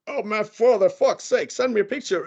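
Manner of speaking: high-pitched retold exasperation; exasperated plea. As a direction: beg in exasperation, pitch jumping up. Retelling his plea on the phone.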